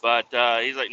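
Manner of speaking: usual speaking voice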